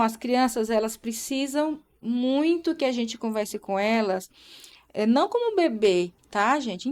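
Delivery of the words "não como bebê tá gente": ordinary talk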